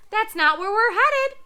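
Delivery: high squawky parrot; loud high bird scold. Acted. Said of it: "flapped the parrot".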